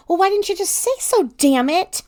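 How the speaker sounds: high, loud exasperation; exasperated outburst. A narrator's reading. You answer Teddy's timid question with an angry outburst. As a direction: burst out at your partner in frustration. Acted quarrel, snapping back at Teddy.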